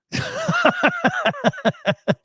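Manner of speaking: long, high burst of real laughter; helpless laughter. Real, not acted. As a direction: lose it completely in a high laugh. Cracking up at the absurd story.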